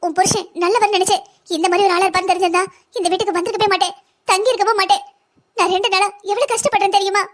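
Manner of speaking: tearful, sobbing accusation; crying. Acted. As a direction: complain through put-on tears. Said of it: "எதுவா இருந்தாலும் அழாம சொல்லு".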